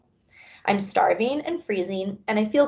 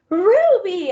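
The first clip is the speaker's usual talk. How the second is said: high, loud joyful cry; exclaim; 11 semitones higher, 7 dB louder than usual. Acted. Bob crying out gladly on seeing Ruby, high and loud. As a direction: greet her with delight. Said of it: "I exclaim".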